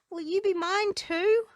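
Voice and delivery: high, eager rising question; hopeful troll. Acted. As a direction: ask with hopeful delight. Troll asks friendship.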